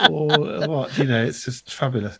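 ordinary delivery

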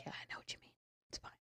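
breathy whispered aside. Pretend. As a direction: whisper it like a secret. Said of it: "The universe is like".

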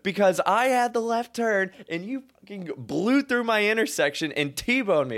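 high, loud indignant rant; arguing. Retold or imitated. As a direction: plead your case in outraged protest. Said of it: "I am in an argument".

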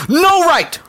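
very high, loud shout; furious outburst. Acted. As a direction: cut in with an angry shout. Mother cuts in and strikes.

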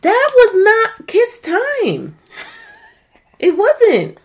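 very high, slow scolding; exasperated scolding. Real, not acted. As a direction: lecture him in disbelief. Scolding the bad kiss.

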